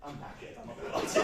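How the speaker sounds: heavy breathing, voice mostly gone. Real, not acted. Knackered comic confessing to the audience after leaning over, out of breath. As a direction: puff it out, worn out from moving. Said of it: "I apologise for the heavy breathing".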